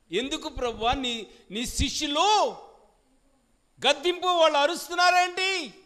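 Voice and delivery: rising outrage at the shouting disciples; complaining Pharisees. Retold or imitated. Pharisees protest to the Lord about the noisy disciples, rising outrage. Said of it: "వాళ్ళని గద్దించు".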